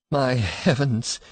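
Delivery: horrified murmur. A narrator's reading. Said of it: "he murmured in an ecstasy of horror".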